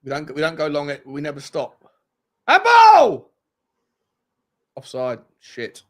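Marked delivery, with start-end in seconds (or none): 2.5-3.3 high, loud outburst; sudden shout. Real, not acted. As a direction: yell it out. Sudden outburst during game.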